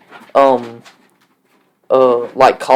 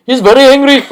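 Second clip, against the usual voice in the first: much higher and louder mock accent; exaggerated Indian accent; 11 semitones higher, 5 dB louder than usual. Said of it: "Will you do an Indian accent?".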